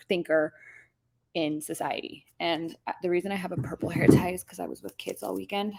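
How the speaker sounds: ordinary talk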